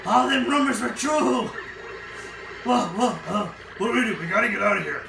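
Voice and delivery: mock panic. Crew panics at the pirate ghost, hammed-up fright.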